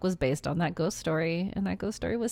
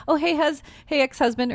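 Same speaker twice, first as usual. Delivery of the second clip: higher, louder mock wife greeting; mock cheery greeting; 8 semitones higher, 4 dB louder than usual. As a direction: chirp it like a fake-sweet ex-wife. Mock quote of movie mom.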